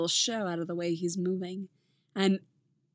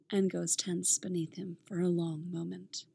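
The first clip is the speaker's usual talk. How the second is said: usual speaking voice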